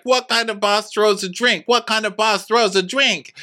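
high, indignant repeated question; mock Wendy's outrage over the thrown drink; pissed Wendy. Wendy repeating her outrage at the boss, high and indignant. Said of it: "Wendy is pissed".

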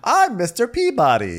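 high, loud put-on announcement; hammed-up alias introduction; silly disguise persona. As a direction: announce a fake identity in a high voice. Voicing Ralph in silly disguises.

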